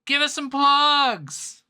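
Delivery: high, slow, loud hype announcement; sing-song host announcing plugs; announcer call. Acted.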